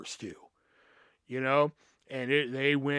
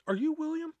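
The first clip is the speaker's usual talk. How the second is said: much higher, curious question; lightly voiced teacher; 13 semitones higher than usual. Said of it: "she was like".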